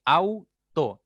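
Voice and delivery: "auto" split as au, to; syllable by syllable. Shown as a demonstration. Teacher separating this word, "auto" in two syllables. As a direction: stretch au as one beat, then to in "auto". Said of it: "al momento de separar esta palabra diré".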